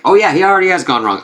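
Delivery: fast, high pop-in remark; Ullr. Retold or imitated. God pops up with bad news, quick high-pitched delivery. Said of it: "Ullr pop up and be like".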